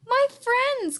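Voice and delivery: high, eager cry; relieved cry, pitched well up. A narrator's reading. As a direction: call out to long-lost friends. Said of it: "cried the elephant".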